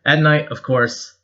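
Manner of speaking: ordinary delivery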